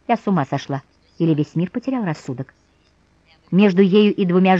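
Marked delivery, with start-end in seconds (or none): none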